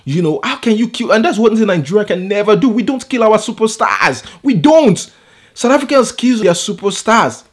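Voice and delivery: high-pitched, impassioned reproach; indignant rant. Real, not acted. Vlogger condemns the killing of African superstars, indignant. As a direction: protest it with real outrage.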